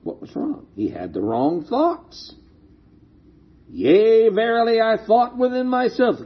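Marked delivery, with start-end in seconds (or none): 0.0-0.7 hushed. Real, not acted. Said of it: "rhetorical question hushed". 3.7-6.2 higher, solemn archaic reading; King James reading. Shown as a demonstration. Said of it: "King James says".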